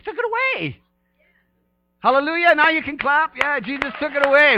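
loud, high, rousing preacher's cry to the church; preaching at full force. Real, not acted. Pastor rallies clapping congregation in loud, high, fervent preaching. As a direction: whip the congregation up, voice ringing high.

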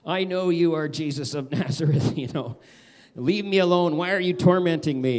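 a hint of a tormented man shouting back; mock screaming demoniac. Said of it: "he screams. He's abusive. He says".

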